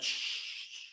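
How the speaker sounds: long hushing "shh" swoosh; erasing sound. Shown as a demonstration. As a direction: imitate an eraser wiping away. Imitates rubbing out with eraser.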